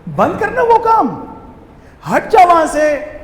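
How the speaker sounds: high, loud imitated telling-off; acted scolding. Servant ordering someone away from there, in a loud acted scolding.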